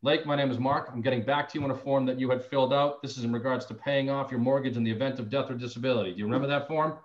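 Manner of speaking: ordinary talk, nothing marked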